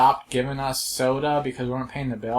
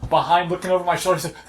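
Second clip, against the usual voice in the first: normal talking voice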